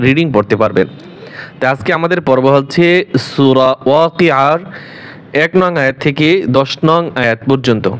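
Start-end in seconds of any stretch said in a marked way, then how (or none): none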